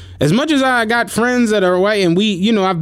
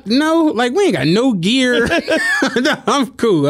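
laughing refusal; 6 semitones higher than usual. Retold or imitated. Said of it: "I'm like".

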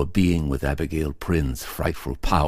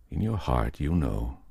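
ordinary delivery

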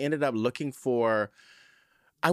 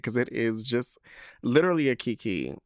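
ordinary talk, nothing marked

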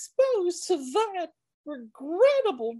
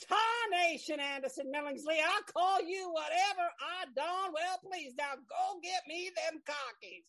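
bossy; 9 dB quieter than usual. Acted. Kitty bosses assistant off mic.

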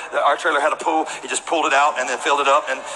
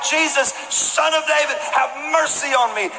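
crying out; 9 semitones higher than usual. Retold or imitated. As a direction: cry out to be heard over the crowd. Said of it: "Bartimaeus is crying out".

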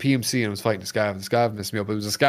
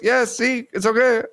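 broken English; 12 semitones higher than usual. Retold or imitated. Friendly scav reassuring wounded player, broken English. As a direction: play the kind foreign player offering help. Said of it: "He goes".

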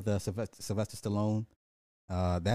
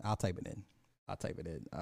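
fading mutter; 4 semitones lower, 9 dB quieter, faster than usual. Real, not acted. Muttering to himself.

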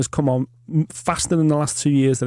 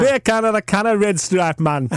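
Jamaican accent; 5 semitones higher than usual. Shown as a demonstration. Joking with his co-host about beer, in a Jamaican accent. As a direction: play up a Jamaican accent with a grin. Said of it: "Now you've gone to the northeast".

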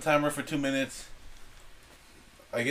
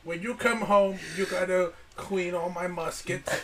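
put-on Chinese restaurant accent; 4 semitones higher than usual. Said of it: "Why do you sound like the guy at the Chinese restaurant?".

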